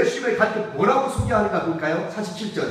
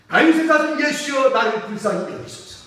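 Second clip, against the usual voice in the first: begging shout that sinks at the end; crying out; 4 semitones higher than usual. Acted. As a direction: cry out to Jesus for mercy. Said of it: "소리를 질러요".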